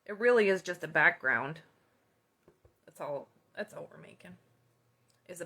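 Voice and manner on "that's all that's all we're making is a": whisper. Real, not acted. Aside to viewers while crafting.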